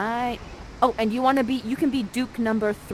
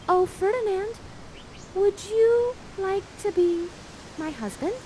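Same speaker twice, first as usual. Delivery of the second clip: Miranda; 11 semitones higher than usual. Acted. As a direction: propose coyly in a high girlish voice. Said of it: "And Miranda says".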